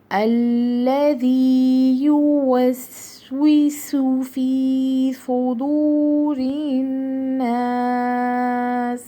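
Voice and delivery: slow, drawn-out tajweed recitation; Quranic recitation. Shown as a demonstration. Teacher recites the verse for her students, slow and chanted. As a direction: recite each word long and measured. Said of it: "واپس سے line کو پڑھتے ہیں".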